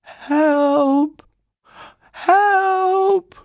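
high, loud drawn-out cries; very loud call for help. A narrator's reading. Paddington stuck in the filling bath, calling very loudly for help. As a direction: yell for rescue at the top of your voice. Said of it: "very loudly".

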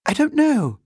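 put-on high child's voice, evasive; mysterious girl. A narrator's reading. Girl dodging the housekeeper's question, mysterious. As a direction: answer high and loud as a girl.

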